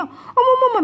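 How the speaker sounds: retold panic of the missionary, high squealing laugh; startled cry. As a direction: squeak out the alarm.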